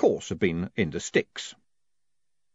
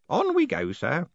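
put-on high-pitched old postman; eager elderly underling; urging; 8 semitones higher than usual. A narrator's reading. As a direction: urge him on in a high voice. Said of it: "Groat urged".

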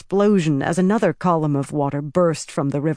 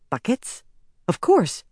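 sudden realization; 6 semitones higher than usual. A narrator's reading.

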